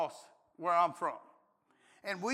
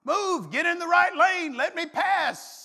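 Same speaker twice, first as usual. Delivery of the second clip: higher, louder, slowing on the end; mock road-rage shout; 7 semitones higher, 5 dB louder, slower than usual. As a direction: order the slow driver out of the lane. Yelling at drivers on interstate.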